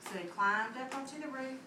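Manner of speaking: plain reading voice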